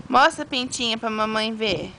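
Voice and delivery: ordinary delivery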